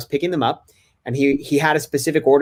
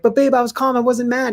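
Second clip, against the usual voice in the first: protesting to wife; 12 semitones higher than usual. Retold or imitated. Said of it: "I was like".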